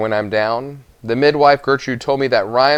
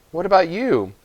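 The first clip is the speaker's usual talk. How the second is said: put-on high, concerned boy's voice; boy's worried question; 8 semitones higher than usual. Boy worrying whether his Gran has eaten. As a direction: ask her, worried for her.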